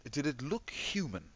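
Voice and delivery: high, hesitant questioning voice; puzzled officer. Acted. Officer quizzing squad about the city, high puzzled voice. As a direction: probe haltingly, pitch climbing through the question.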